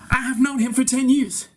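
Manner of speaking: insisting he has known the barber for years; emphatic repeat. Acted. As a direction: lean on "have".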